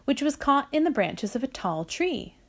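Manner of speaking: plain reading voice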